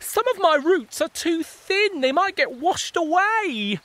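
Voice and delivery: high, fretful little voice; worried roots. Acted. Plant roots telling the fungi they're worried. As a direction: fret about being washed away.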